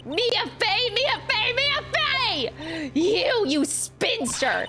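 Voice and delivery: shrieking villainess. Acted. Villain shrieking in fury at her old enemy. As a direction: scream her hatred at the ghost.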